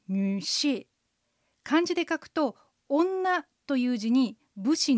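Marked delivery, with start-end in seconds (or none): 0.1-0.9 女士 in Mandarin, nǚshì with 女 dipping and rising in the third tone and 士 falling in the fourth; teacher models 女士 slowly and clearly; Chinese drill word. Shown as a demonstration. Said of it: "続けて、". (女士を中国語で、女は三声で下がって上がり、士は四声で下げる)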